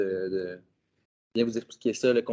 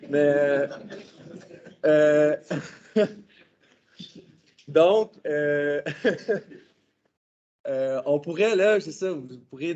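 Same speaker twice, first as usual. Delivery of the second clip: too much caffeine; 3 semitones higher, 3 dB louder, slower than usual. Real, not acted. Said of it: "J'ai pris trop de café".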